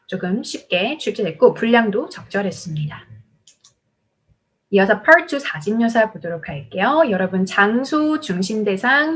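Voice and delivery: ordinary talk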